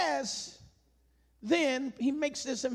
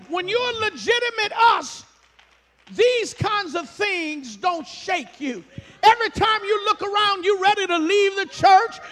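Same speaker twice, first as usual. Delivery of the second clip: loud, driving preacher's call; preaching at full force; 5 semitones higher, 6 dB louder than usual. Acted. Pastor challenging the church about leaving, at full force. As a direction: press it on the congregation hard.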